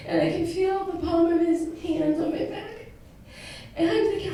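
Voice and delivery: high, soft, tearful telling; fighting back tears as you tell it; choked up. Real, not acted. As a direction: voice breaking with emotion. Emotional climax, near tears.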